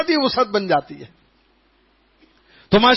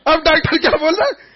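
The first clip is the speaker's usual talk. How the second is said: excited; 8 semitones higher, 3 dB louder than usual. Real, not acted. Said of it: "rhetorical raised".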